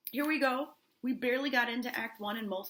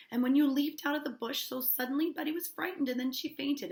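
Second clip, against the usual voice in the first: higher-pitched, pleading explanation; urgent defence; 7 semitones higher, 5 dB louder than usual. Acted. Niece explaining the dancing to her uncle, urgent higher voice. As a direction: act out a niece hurriedly excusing herself.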